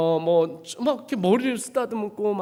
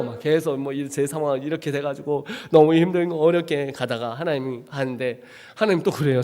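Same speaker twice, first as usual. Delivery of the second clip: normal talking voice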